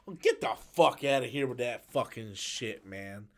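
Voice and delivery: ordinary delivery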